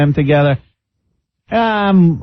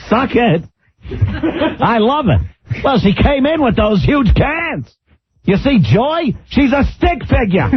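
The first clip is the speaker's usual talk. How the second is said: Regis impression; 9 semitones higher than usual. Mocking Regis on air.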